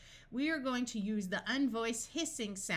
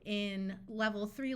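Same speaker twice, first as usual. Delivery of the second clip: usual speaking voice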